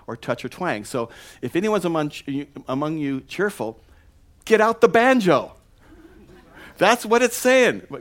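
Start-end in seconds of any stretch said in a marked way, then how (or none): 4.4-7.9 excited. Real, not acted. Joking punchline about banjos.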